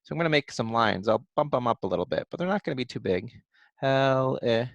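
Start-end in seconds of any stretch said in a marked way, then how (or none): 3.8-4.7 "Helicopter" broken into four parts with long gaps; syllable by syllable. Shown as a demonstration. Teacher draws a line for each syllable, saying them separately. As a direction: say "Helicopter" part by part as you write. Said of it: "spell out each syllable one part at a time".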